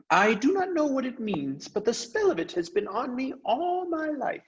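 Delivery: a tiny gallant mouse speaking with lofty pride; Reepicheep, brave mouse. Acted. Mouse tells the crew of his quest, high and noble.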